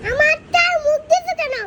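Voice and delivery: loud, whiny protest; upset complaint. Real, not acted. Child upset over shared spoon.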